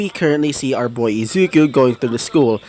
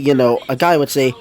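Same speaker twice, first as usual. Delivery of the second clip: ordinary delivery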